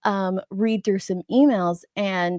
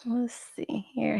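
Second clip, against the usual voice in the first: whispering; 10 dB quieter than usual. Real, not acted. Reading chat, muttering to self.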